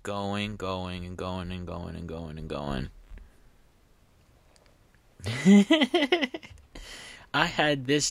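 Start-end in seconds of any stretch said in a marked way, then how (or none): 0.0-2.9 steadily sinking, fading drone; endlessly droning on. Shown as a demonstration. Reader scrolling down an endless word, droning on and fading. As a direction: repeat it in a monotone, sliding lower and softer.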